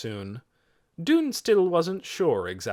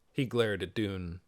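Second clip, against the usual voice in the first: usual speaking voice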